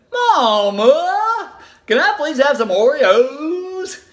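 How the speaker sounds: put-on high, drawn-out begging child voice; pleading little child. Little girl begging her mom for cookies, drawn-out pleading. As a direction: stretch it out like a small kid begging.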